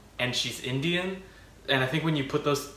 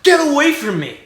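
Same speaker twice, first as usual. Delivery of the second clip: sudden loud yell, higher; angry yell; 13 semitones higher, 10 dB louder than usual. Retold or imitated. Kevin turning on the heckler, loud angry yell. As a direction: snap it out like a cornered teen. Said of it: "and goes".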